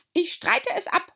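very high hare voice; squeaky March Hare. A narrator's reading.

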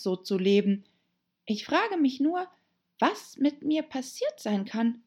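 high, animated voice of an unhappy heroine; musing Alice. Acted. Alice talking to herself about her strange adventure, high and wondering. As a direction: think aloud in a high, lilting voice.

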